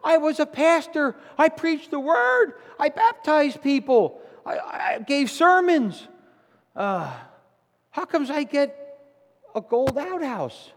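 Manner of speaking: high, loud, outraged protest; wounded pastor demanding an explanation; indignant. Acted. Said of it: "the pastor is, like, indignant".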